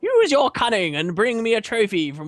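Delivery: squeaky scheming rat; high twitchy rodent voice. Acted. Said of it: "I'm doing the rat voice".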